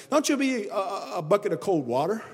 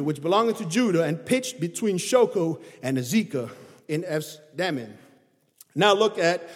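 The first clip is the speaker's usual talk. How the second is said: scripture reading; 2 semitones lower, 3 dB louder than usual. Acted. Reads scripture aloud in sermon.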